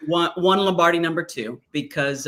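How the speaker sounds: ordinary talk, nothing marked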